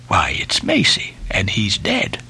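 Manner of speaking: low, breath-edged realisation; hushed, low mutter. A narrator's reading. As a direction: drop low as the grim news sinks in.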